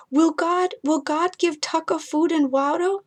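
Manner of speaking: put-on a small boy asking if the monster is gone; scared little boy. Ethan asks his mother about the monster, high and small.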